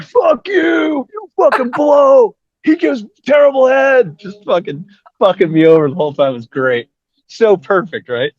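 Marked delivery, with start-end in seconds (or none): 0.0-4.2 high, drawn-out mock shout; imitated yelled jeering; heckling. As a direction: bellow insults like a relentless heckler. Retelling heckler's abuse after each song.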